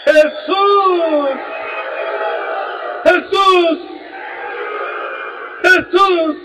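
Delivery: high, heartfelt cries of the name; fervent calling. Shown as a demonstration. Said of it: "con lo de lo profundo de su ser".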